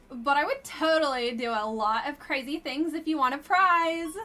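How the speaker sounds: put-on ditzy Valley Girl read, lifted pitch. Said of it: "This should be done in Valley Girl voice".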